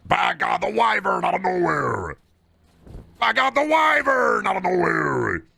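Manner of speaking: high, loud shouted ringside call; wrestling announcer call. Pretend. Streamer calling the Wyvern ambush like a wrestling announcer, shouting. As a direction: bellow it like a wrestling commentator losing his mind. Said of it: "much more a Randy Orton thing".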